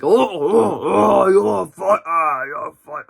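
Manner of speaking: hammed-up villain taunting his captive; mock villain taunt. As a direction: taunt her in a put-on villain voice. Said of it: "he's like".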